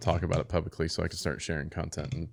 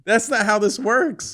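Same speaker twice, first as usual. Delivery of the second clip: laughing protest; 8 dB louder, slower than usual. Retold or imitated.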